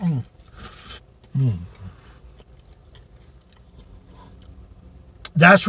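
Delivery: chewing a big bite; talking while eating; mouth full. Real, not acted. Seen 0: Bites at 0.2, cheeks full and chewing through 5.6s, frosting on mouth.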